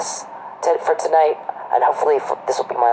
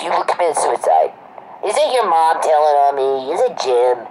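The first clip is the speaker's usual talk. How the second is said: higher-pitched mock of a menacing schemer; mocking imitation; 4 semitones higher than usual. Acting out the harassers' threats against the speaker, mocking and high-pitched. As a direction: rattle off threats in a raised, mocking voice.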